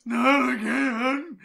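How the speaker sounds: high, loud, stretched mock terror; imitating scared cry. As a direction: draw it out like a frightened yelp. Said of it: "He sounded really fucking scared".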